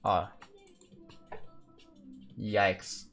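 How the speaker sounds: usual speaking voice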